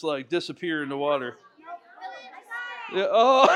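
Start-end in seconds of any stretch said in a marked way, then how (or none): 2.9-3.6 laughing. Real, not acted. Bills fall apart.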